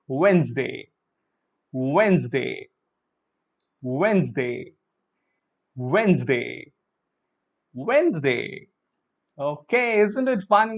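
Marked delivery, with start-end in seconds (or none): 0.1-8.7 "Wednesday" as wenz-day each time; correct Wednesday, repeated. Shown as a demonstration. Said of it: "pronounce with me five times".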